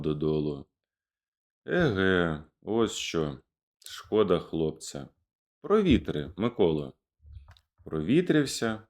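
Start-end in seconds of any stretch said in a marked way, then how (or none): none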